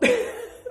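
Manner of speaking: sudden high burst of laughter. Real, not acted. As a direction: squeal of a laugh. Kids chaos upstairs.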